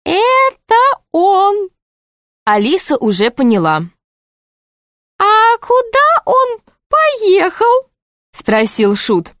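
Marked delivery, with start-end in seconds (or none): 0.0-1.7 very high, slow jester voice; high, lingering jester aside; jester's aside. A narrator's reading. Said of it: "сказал тихо". 5.2-7.9 very high, slow jester voice; drawn-out, high jester question; high-voiced jester.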